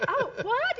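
high, loud mock shock; taken aback. Mom retells hearing her son's refusal, mock shock. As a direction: react as a stunned mom, half laughing.

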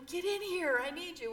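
calling the friends in from outside the window; urgent mock shout through the window; retold call.

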